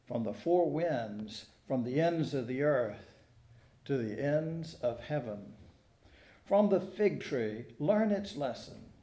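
ordinary talk

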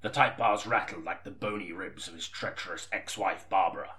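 low, quiet brooding narration; noir story reading. Acted. Writer reading his noir story aloud, low and brooding. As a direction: read it like a gloomy pulp novel.

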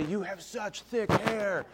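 slow, drawn-out, gushing compliment; slow syrupy salon-lady voice; flattering hairdresser. Retold or imitated. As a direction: coo it like a fawning hairdresser. Hairdressers' flattering remark quoted.

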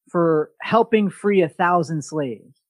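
normal talking voice

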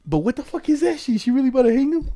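can't believe what you just heard; shocked disbelief. Real, not acted. Friend reacting to grandma almost hanging his dad, shocked.

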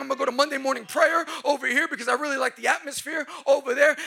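loud, driving pulpit voice; full-throated sermon build; preaching at full force. Acted. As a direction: hammer it home to the crowd.

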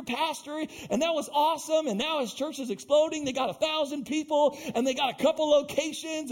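high, excited fan imitation; mock gushing. Admirer envies pastor's new church, high mock gushing. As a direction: gush on in an imitated awestruck rush.

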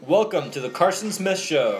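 announcer. Pretend. Mock show host opening.

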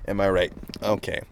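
usual speaking voice

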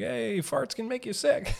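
high, laughing jokey call; joking with the guys. Pretend. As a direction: toss it out high, cracking up.

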